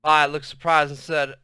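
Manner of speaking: usual speaking voice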